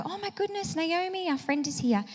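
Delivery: high-pitched, hushed exclamation of amazement; excited greeting. Acted. Naomi's friends run out to greet her, excited.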